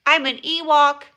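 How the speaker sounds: loud high creature voice; Ewok voice. Pretend. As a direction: proudly squeak like an Ewok. Announces being an Ewok.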